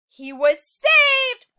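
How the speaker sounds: high, loud, slow triumphant call; relieved cheer. A narrator's reading. Teacher cheering the seed's rescue for the class, joyful. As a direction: celebrate the rescue.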